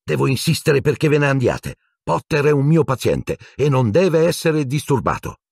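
high, indignant insistence; angry. A narrator's reading. Nurse ordering visitors away from her patient, angry. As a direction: crossly lay down the rule. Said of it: "disse arrabbiata Madame Pomfrey".

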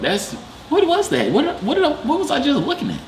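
very high, baffled questioning; baffled disbelief. Real, not acted. Asking what he was just looking at, baffled disbelief. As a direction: pitch climbing way up in disbelief.

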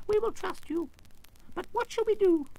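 very high little royal squeak; squeaky mouse queen. A narrator's reading. Mouse queen explaining her rescue, squeaky. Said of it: "in a squeaky little voice".

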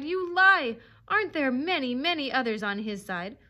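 high, loud rebuttal, easing slightly; frenzied indignant retort; cried protest. A narrator's reading. Dog defending his master's side, crying out. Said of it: "he cried".

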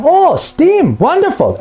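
high, mock-enthusiastic gush; sarcastic fake delight. A customer hearing the sales pitch, mock-thrilled. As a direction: pretend to be thrilled about a feature you won't use. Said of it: "you're thinking".

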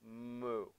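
low, soft mooing; cow moo. Shown as a demonstration. Teacher leading the kids in cow pose, low moo. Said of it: "cow pose".

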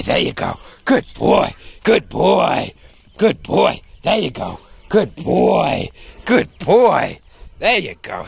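playful handler's praise; encouraging praise. Real, not acted. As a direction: cheer a dog on as it tugs. Praising dog pulling on toy.

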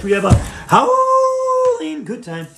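high slow howled line; mock dog howl.

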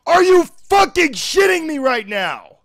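high, loud, incredulous yell; outraged shout. Real, not acted. Player mad at the game right now, outraged shout. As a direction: shout it in rising exasperation.

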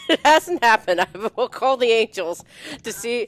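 high and loud, laughing through the words. Real, not acted. Host laughing that the angels haven't helped yet. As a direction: laugh it out in disbelief.

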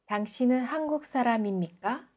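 normal talking voice

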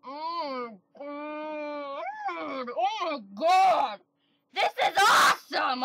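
hushed awe rising to shrieks; dawning joy. Real, not acted. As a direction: build from a whisper to screaming.

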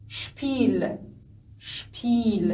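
شپیل with the "ee" drawn out; long "ee". Shown as a demonstration. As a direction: hold the vowel in شپیل for learners. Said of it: "نگاه کنید ای کشیده شد".

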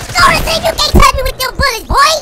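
yelling at the enemy player, hyped and taunting; hype taunting yells. Real, not acted. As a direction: scream taunts at the rival mid-fight. Yelling taunts during a Fortnite fight.

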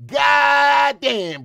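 loud drawn-out goddamn yell; exasperated shout. Real, not acted. Reacting to the clip, a loud exasperated goddamn. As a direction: shout it in disbelief.

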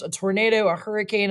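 usual speaking voice

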